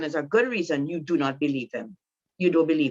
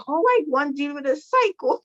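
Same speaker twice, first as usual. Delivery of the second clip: poor man voice; 9 semitones higher than usual. Retold or imitated. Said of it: "the poor man like".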